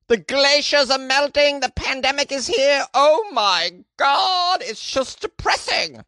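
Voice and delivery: high, slow wailing British falsetto; Monty Python accent. Acted. Podcaster lamenting world news in a Monty Python accent. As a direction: wail the bad news like a Python old woman. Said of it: "I don't know why I did that accent".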